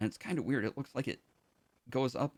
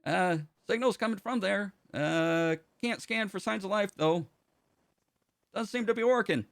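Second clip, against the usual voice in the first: chirpy assistant answering a call; helpful ship AI; 10 semitones higher than usual. Acted. GM voices ship computer Tonic.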